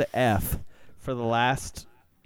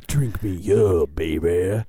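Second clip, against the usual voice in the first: slow seductive ad read; sultry voice; 5 semitones lower, 3 dB louder, slower than usual. Acted. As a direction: purr it like a sexy commercial. Jingle line.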